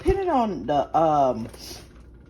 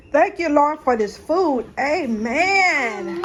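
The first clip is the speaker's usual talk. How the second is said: saying grace; 9 semitones higher, 5 dB louder, slower than usual. Real, not acted. Mom says grace over lunch.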